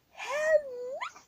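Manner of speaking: high shouted hell no; retold shout. Retelling shouting at the girl, a high shouted cry. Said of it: "I just remember shouting".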